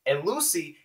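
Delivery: ordinary delivery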